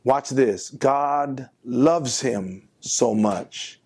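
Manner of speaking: ordinary talk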